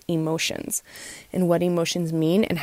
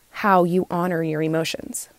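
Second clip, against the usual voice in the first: ordinary delivery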